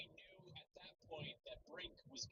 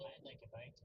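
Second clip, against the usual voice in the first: ordinary talk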